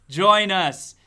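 loud booming join us; loud eerie call. Acted. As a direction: call them in, big and creepy. Spooky repeated invitation.

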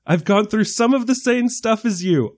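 imitated fury. Woman setting him straight about the British, mildly imitated fury. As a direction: insist on it, indignant but not shouting. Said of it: "I love her fury at him".

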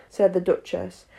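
usual speaking voice

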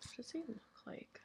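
hushed question; whisper. Real, not acted. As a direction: whisper it under your breath. Whispered question about the prince.

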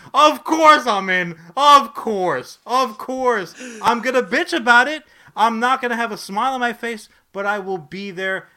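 very loud, high complaint; loud grudging rant. Real, not acted. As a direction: bellow it, resigned but loud.

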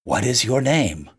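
low, understated emotional question; a touch of choked-up feeling, pitched low; husky with emotion. A narrator's reading. Stranger asks the blind man his name, husky with emotion. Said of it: "in a voice husky with emotion".

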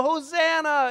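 high desperate cry; yelled Hosanna. Retold or imitated. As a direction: cry out with the last breath. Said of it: "you would yell".

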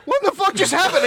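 high-pitched mock disbelief; baffled onlooker. Comedian imagining the audition from her end, mock bafflement. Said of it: "imagine from her end watching that".

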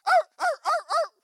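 very high, loud yelping; imitated dog yelps. Dog kicked in the face turns away, high loud yelps.

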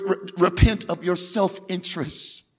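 usual speaking voice

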